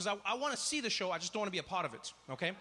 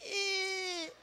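strained grunt. Retold or imitated. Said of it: "he'd make the same sound".

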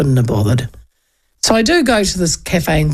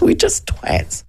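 self-mocking aside; 12 semitones higher, slower than usual. Real, not acted. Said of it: "no wonder you guys don't like Aucklanders".

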